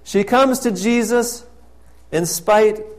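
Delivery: loud, ringing pulpit proclamation; fervent preacher's call; preaching at full force. Acted. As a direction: proclaim it to the whole church. Loud preaching of prostitute story.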